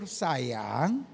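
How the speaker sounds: softly chiding husband, low and unhurried; soft, low, patient mock admonishment; gentle husband's reproach. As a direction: play a husband mildly correcting his wife. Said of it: "Lalu suaminya tegur".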